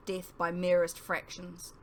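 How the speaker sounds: ordinary delivery, nothing marked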